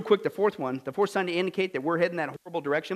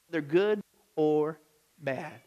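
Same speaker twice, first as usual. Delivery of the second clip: ordinary delivery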